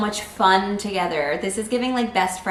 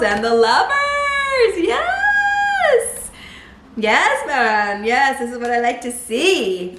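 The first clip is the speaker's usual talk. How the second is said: very high, loud celebratory shout; thrilled outburst; 7 semitones higher, 8 dB louder, much slower than usual. Real, not acted. Tarot reader thrilled by the Lovers card, excited shouting. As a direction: cheer with long, drawn-out squeals. Thrilled reaction to cards drawn.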